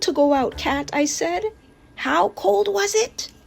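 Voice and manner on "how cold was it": plain reading voice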